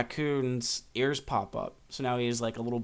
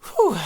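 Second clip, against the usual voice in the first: whew; 10 dB louder than usual. Real, not acted. Overcome talking about sad chapter.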